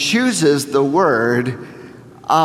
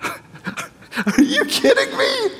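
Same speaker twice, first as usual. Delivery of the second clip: laughing in disbelief, voice cracking way up; amazed laugh; slower than usual. Real, not acted. Preacher laughing in amazement at Jesus's words. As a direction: burst into an overwhelmed, cracking laugh.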